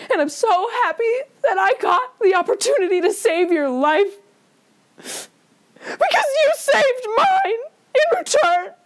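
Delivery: high, hammed-up tearful voice; mock choking up. Podcaster thanking Larry for saving him, mock tearful. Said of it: "I'm choking up".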